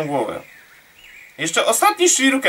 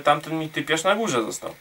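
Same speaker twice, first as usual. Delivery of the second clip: ordinary talk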